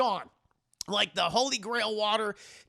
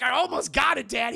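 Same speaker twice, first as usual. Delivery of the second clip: higher, louder strained reach; straining shout; 4 semitones higher, 5 dB louder than usual. Retold or imitated. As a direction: gasp it out while reaching. Indy reaching for grail.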